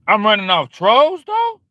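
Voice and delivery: boastful rising question. Real, not acted. Boasting about scaring trolls.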